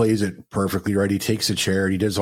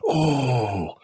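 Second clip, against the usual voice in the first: mock crowd reaction; 4 semitones higher than usual. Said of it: "you hear the crowd go".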